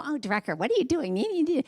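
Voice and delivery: a hint of a scolding inner critic, lifting on the question; lightly voiced nagging self-doubt. Her inner critic nagging her, a lightly voiced scold. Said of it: "inner critic that will come up and go".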